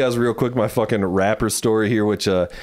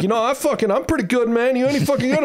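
high, cocky trash talk; 12 semitones higher, faster than usual. Retold or imitated. Mocking the rapper with a high cocky challenge. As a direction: show off and throw it back at him. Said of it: "really talking shit back to him".

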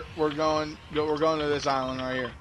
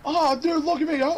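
excited; 13 semitones higher, 3 dB louder than usual. Real, not acted. Excited about being a fish.

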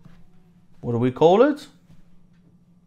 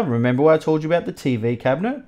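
ordinary talk

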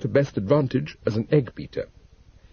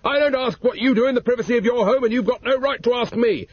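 higher, lightly acted indignant husband; indignant protest; 10 semitones higher than usual. A narrator's reading. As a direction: defend his privacy huffily.

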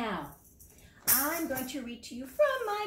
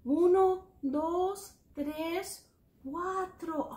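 higher-pitched count without long gaps; brisk recount; 4 semitones higher, much slower than usual. Shown as a demonstration. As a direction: run through the numbers in one steady series. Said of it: "How many is cuatro?".

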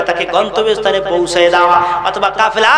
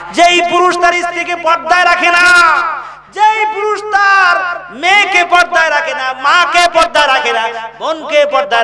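high, insistent sermon cry; preacher's high-pitched repeated refrain, easing down at the end; forceful preaching; 10 semitones higher than usual. Real, not acted. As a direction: drive home each item in a ringing raised voice.

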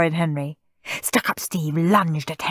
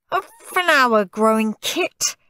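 howling; 6 semitones higher than usual. A narrator's reading. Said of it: "howled Stuck-up Steve".

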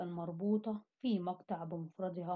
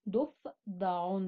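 slow syllable demo; 2 semitones lower, much slower than usual. Shown as a demonstration. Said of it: "الحرف الناقص هو حرف الفاء".

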